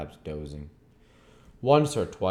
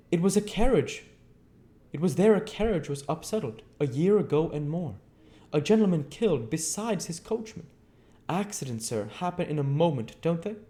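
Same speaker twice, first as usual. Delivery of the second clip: high, chattering landlady voice; a gossipy country landlady, pitched up; lightly rustic landlady gabbling on; Mrs Hall's voice; 12 semitones higher, 5 dB louder than usual. A narrator's reading.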